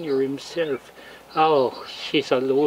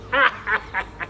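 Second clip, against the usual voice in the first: soft chuckle; 3 dB quieter than usual. Real, not acted. Laughing at own joke.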